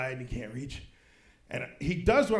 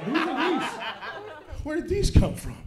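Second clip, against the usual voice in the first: baffled surprise; 6 semitones higher, slower than usual. Retold or imitated. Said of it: "I was like".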